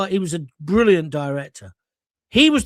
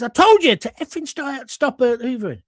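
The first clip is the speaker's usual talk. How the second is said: angry director; 6 semitones higher, 5 dB louder than usual. Retold or imitated. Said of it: "And he says".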